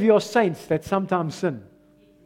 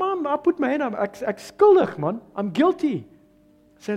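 normal talking voice

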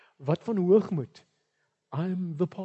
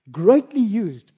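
greatly used slowed and louder; emphatic; 5 semitones higher, 10 dB louder, much slower than usual. Real, not acted. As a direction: lean hard on greatly used. Emphatic sermon phrase.

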